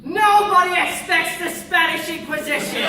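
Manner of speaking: loud, hammed-up announcement; dramatic proclamation. Inquisition bursting in, hammed-up loud proclamation.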